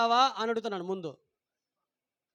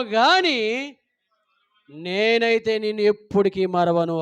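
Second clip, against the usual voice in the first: slow, louder proclamation of the promise; preaching God's words; 4 dB louder than usual. Acted. Preacher proclaims God's promise to the congregation, slow and loud. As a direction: declaim it slowly as God speaking.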